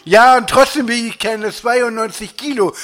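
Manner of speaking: high, loud retort; indignant protest. Acted. Dieter argues with Laura over the weight, indignant protest.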